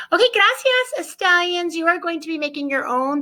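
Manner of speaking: high, bright farewell; cheery sign-off. Real, not acted. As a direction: sing out the goodbye brightly. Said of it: "sign-off".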